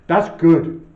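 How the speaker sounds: Lenny's voice. Acted. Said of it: "our third voice ... which is Lenny".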